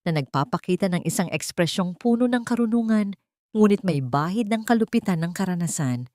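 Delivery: ordinary delivery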